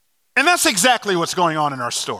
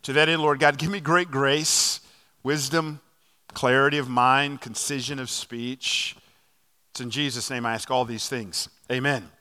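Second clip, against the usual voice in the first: soft, low, reverent prayer voice; 8 semitones lower, 6 dB quieter than usual. Real, not acted. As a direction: pray it quietly and humbly. Opening prayer before sermon.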